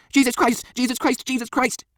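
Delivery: very high, repeated cries of alarm; panicked exclaiming. A narrator's reading. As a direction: wail it over and over in panic. Man kicked to floor, panicking.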